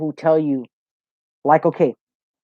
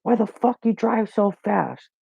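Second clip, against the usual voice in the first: higher-pitched, drawn-out imitation of an irate old man; mock cussing; 7 semitones higher, slower than usual. Old man scolding a maintenance worker for speeding, mock cussing. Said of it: "he was all cussing at me".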